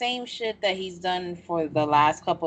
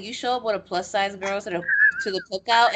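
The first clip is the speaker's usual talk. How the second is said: ordinary talk, nothing marked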